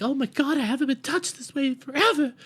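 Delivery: high-pitched, overwrought grandma; old grandma impression. Grandma reacting to Ruby's piano playing, hammed-up old lady voice. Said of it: "Old grandma over here is like".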